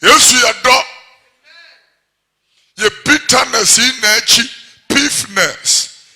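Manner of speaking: loud, driving sermon delivery; full-force preaching. Acted. Preacher hammering scripture at the congregation, loud.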